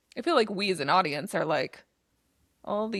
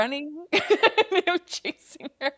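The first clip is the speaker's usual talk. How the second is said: laughing through the repetition; tickled, higher voice breaking into laughter; 7 semitones higher, slower than usual. Real, not acted. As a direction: crack up while retelling it. Laughing at endless chase scene.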